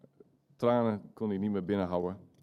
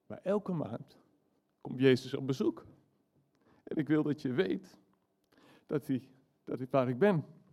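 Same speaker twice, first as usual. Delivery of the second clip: a hint of a frail old man, pitched higher; half-hearted dying-grandpa voice, admittedly not quite right; a lightly sketched old man's voice, raised in pitch; old grandfather, lightly voiced; 5 semitones higher than usual. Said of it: "Ik kan het niet zo goed doen hoor".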